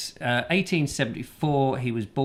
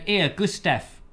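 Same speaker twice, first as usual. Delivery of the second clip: higher-pitched matey English call; English mate calling; 6 semitones higher than usual. Acted. Gustav's English mates hailing him, higher mock-matey call. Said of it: "with his mates going".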